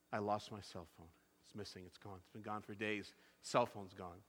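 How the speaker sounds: very low, hushed retold lament; retold dejection. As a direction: murmur it softly as the upset hairstylist. Retold hairstylist laments lost phone.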